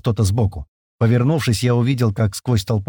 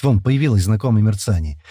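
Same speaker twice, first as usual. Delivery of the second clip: ordinary delivery, nothing marked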